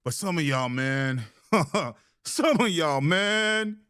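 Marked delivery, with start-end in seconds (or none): 1.5-3.8 high laughing jab. Real, not acted. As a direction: laugh it out mockingly. Laughing mockery of the chat.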